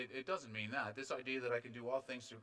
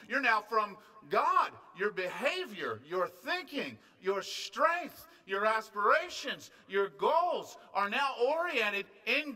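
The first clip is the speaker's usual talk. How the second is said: loud, raised, emphatic pulpit delivery; forceful preaching; 7 semitones higher, 9 dB louder than usual. Real, not acted. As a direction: drive each point home, stretching key words.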